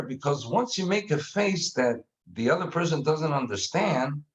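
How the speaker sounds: usual speaking voice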